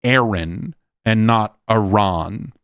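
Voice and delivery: slow, exaggerated contrast between "Aaron" like the man's name and "Aran" with a short open a; Aaron versus Aran. Shown as a demonstration. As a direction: spell out "Aaron" then "Aran", slowly and deliberately.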